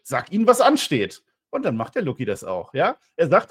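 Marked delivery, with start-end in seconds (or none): 0.0-1.2 high, loud, slow mock order from Gunther; imitating Gunther's command. Podcaster retelling Gunther ordering Ludwig Kaiser backstage, a mock stern command. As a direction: bark it out slowly like a hammed-up stern boss. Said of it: "er sagt auf Deutsch".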